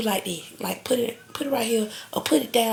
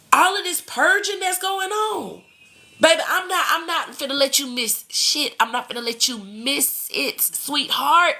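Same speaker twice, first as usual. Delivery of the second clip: excited; 6 semitones higher than usual. Real, not acted. Excited promise to viewers.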